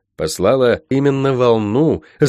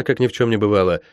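ordinary delivery

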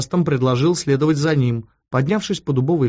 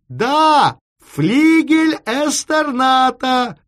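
high, slow yell of "Флигель Эстерната"; shouting; 10 semitones higher, 4 dB louder, much slower than usual. A narrator's reading. Chief confirming the address by telephone, "Флигель Эстерната" shouted slowly. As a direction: shout "Эстерната" as one whole word. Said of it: "и закричал ещё громче".

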